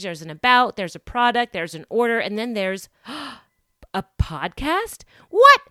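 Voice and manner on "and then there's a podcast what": high, loud gasping disbelief; mock amazed discovery. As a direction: play a shopper thrilled to find a podcast link. Acted shock at finding podcast.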